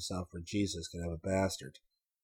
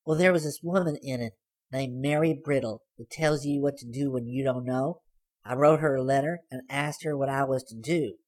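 put-on higher, louder girl's voice teasing; sly girl; 6 semitones higher, 4 dB louder than usual. A narrator's reading. Girl surprising Hayes from the back seat, teasing. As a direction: pipe up high and pleased with herself.

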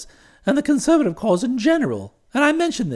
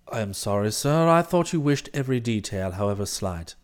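low, soft, measured valet's report; composed valet Jeeves; 7 dB quieter than usual. Acted. Valet reporting the meeting to his employer, composed and formal. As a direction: report every detail with unruffled formality.

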